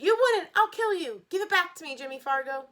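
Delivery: put-on high, loud shrieking girl; girl screaming at the boy who grabbed her booklet. A narrator's reading. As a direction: scream at him in panic. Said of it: "Sheila screamed".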